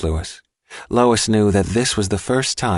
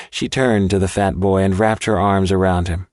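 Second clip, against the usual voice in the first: ordinary delivery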